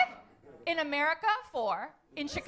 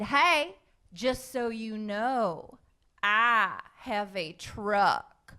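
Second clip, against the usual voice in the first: slow, lower drawl of a guy hitting on her; slow come-on; 5 semitones lower, much slower than usual. Retold or imitated. Line-dance partner showing off his truck, slow and low. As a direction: lay it on slow like a cowboy bragging. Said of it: "he said this to me".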